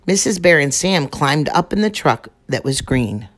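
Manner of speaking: ordinary delivery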